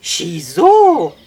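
loud, drawn-out call of a name. A narrator's reading. Sam recognising the boy on horseback, calling out. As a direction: cry out in recognition. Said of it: "rief Sam".

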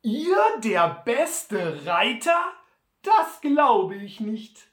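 höher, lauter und langsam ungläubig; Sam ungläubig. Acted. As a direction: zweifelnd und gedehnt wie Sam. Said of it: "fragte dann ungläubig". (Sam zweifelt laut und langsam an Butler)

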